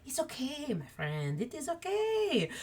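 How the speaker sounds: reassuring. Acted. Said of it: "and he's like".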